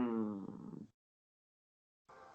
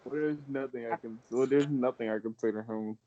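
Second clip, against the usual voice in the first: ordinary delivery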